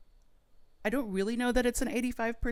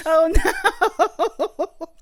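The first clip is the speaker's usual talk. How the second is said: laughing; 12 semitones higher, 12 dB louder than usual. Real, not acted. Reacting to a terrible score.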